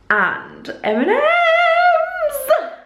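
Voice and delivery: high, slow sing-song M and M's; drawn-out excitement. Real, not acted. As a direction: stretch it out with glee. Drawn-out excited food list.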